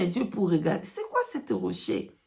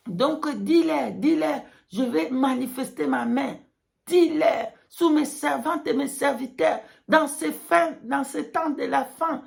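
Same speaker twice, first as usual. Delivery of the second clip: preaching at full force; 4 semitones higher than usual. Pretend. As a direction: cry it out fervently. God's message quoted.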